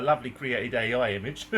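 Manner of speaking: ordinary talk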